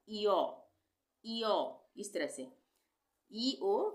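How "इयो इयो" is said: इयो said as two syllables, "ee-yo"; pronunciation demo. Shown as a demonstration. Teacher to students, showing इयो as "ee-yo". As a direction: sound out इयो slowly and clearly, "ee-yo". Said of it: "तो ईओ का उच्चारण क्या हो जाएगा?".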